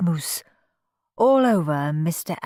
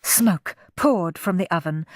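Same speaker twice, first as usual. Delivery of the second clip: ordinary delivery, nothing marked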